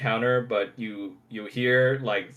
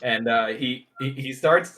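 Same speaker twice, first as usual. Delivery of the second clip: ordinary talk, nothing marked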